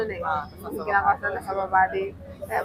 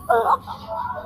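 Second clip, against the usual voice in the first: high squealing laugh-shriek; 14 semitones higher than usual. Real, not acted. Reacting to ate girl. (malakas na tiling tawa)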